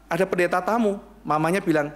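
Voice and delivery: ordinary talk, nothing marked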